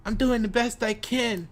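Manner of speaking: high-pitched mimicked whine of excuse; mock excuse-maker. Speaker mimicking people who say they do their best, mock high voice.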